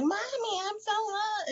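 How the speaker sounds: put-on high, whining little kid pleading; whiny child voice. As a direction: whine it like a tired toddler complaining. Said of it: "just the whiny voice".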